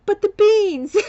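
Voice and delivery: high, loud, laughing wail; laughing protest. Retold or imitated. To the arresting cops, a high laughing protest about beans. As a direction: cry out through laughter. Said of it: "I'm like".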